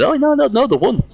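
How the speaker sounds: high mock voice; quoting Bush. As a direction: squeak it out as the friend. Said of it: "Bush said".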